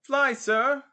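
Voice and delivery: high, loud street tout's cry; exclaimed call. Acted. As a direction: shout for a customer across the street. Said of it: "exclaimed a chorus of fourteen men and six boys".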